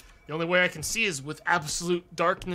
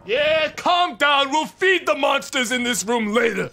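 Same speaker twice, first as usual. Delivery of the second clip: yelling; 8 semitones higher, 10 dB louder than usual. Real, not acted. Yelling at game monsters.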